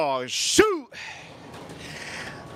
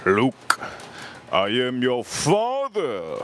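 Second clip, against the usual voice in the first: lower, slow, heavy villain voice; slow, low movie-villain impression; Darth Vader; 4 semitones lower, much slower than usual. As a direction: intone it like a masked space villain. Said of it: "I sound like the Darth Vader".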